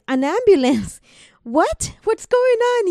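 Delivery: high, loud cry of alarm; panicked disbelief. Retold or imitated. Woman told her sister needs an ambulance, panicked high cry. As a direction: blurt it out as the panic hits.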